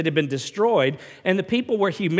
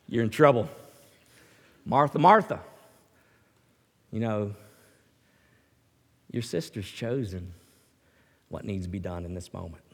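gentle rebuke; 10 semitones lower, 4 dB quieter than usual. Acted. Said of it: "And Jesus said".

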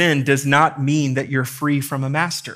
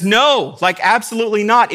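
"No" punched high and loud, then "absolutely not"; flatly refuse, hitting "No" hard and high; emphatic no; 7 semitones higher, 5 dB louder than usual. Acted. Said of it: "It's emphatic".

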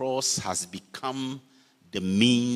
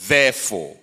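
"Therefore" spoken alone, firm and louder with a falling end; stressed therefore; 3 semitones lower, 5 dB louder than usual. Shown as a demonstration. Preacher to congregation, quoting Romans, louder "Therefore" with a fall. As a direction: set the word "Therefore" apart as a weighty quote. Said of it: "verse one begins with".

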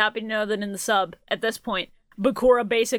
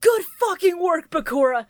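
shouting each line, slow and high; shouted all-caps note; 9 semitones higher, much slower than usual. Shown as a demonstration. Reading an all-caps fan note aloud to the hosts, shouting. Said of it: "I'm gonna shout them".

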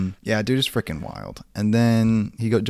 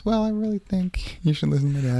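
lightly voiced girlfriend, pitched higher; 10 semitones higher than usual. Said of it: "And he goes".

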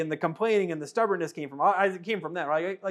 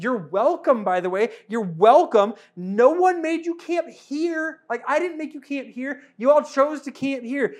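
exasperated Moses; 5 semitones higher, 3 dB louder than usual. Acted. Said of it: "Moses is going like".

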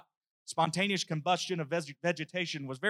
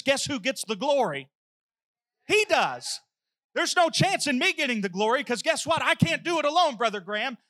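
rising revival preaching; preaching at full force; 5 semitones higher than usual. Acted. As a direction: preach it to the rafters.

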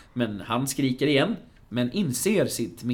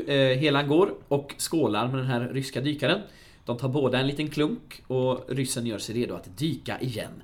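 ordinary talk

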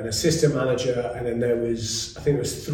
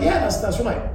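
eager agreement; 11 semitones higher than usual. Retold or imitated. Agreeing with the podcast guest.

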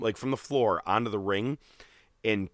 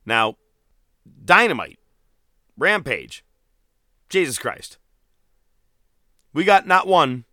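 hyped up; 5 semitones higher, 6 dB louder than usual. Real, not acted. Hyping the wrestling shows.

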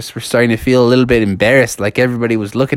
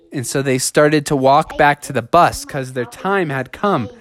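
plain reading voice

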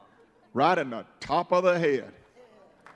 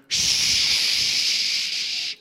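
long shushing hiss. Acted. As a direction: hush the noisy man with a long shh. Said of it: "And the disciple said".

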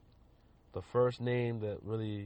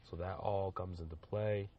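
normal talking voice

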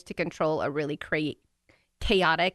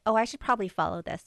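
mock innocent; 8 semitones higher than usual. Mocking Harry sneaking off.

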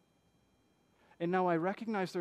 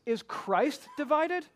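slow, high and loud, each word weighed; slow emphatic question; 8 semitones higher, 5 dB louder, slower than usual. Acted. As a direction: let the question hang, slowly. Paul's rhetorical question.

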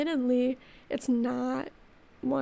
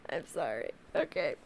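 tearful whisper; 10 semitones lower, 4 dB quieter than usual. Real, not acted. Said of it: "I'm gonna compose myself".

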